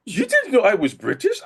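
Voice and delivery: high-pitched imitation of a British co-star; British accent. Co-star revealing he is British, imitated British accent. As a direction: mimic a British accent, half laughing. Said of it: "starts talking in this British accent".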